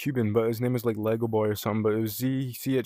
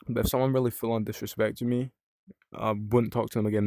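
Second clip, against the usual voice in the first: normal talking voice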